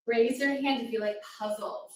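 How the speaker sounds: ordinary talk, nothing marked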